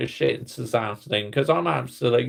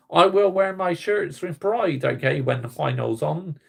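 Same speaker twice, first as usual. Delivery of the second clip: ordinary talk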